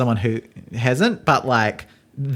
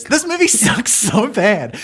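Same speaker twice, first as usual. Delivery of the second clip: higher, slower, theatrical whine; mock-dramatic complaint; 7 semitones higher, slower than usual. Real, not acted. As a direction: overplay the disgust, pitched up. Groaning about a bad movie.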